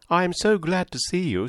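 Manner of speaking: high, gushing society chatterbox; gushing Mrs Palmer. Acted. Mrs Palmer greeting the Dashwood sisters, high and gushing. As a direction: bubble over with delight.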